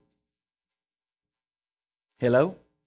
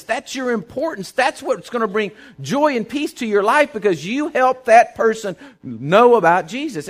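louder and higher, pressing the point home; rising preaching; 7 semitones higher, 5 dB louder than usual. Acted. Pastor to his church about leading people to Jesus, fervent preaching. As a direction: preach it with full fervor.

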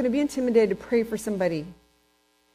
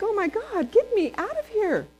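desperate; 11 semitones higher than usual. Retold or imitated. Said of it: "I'm like".

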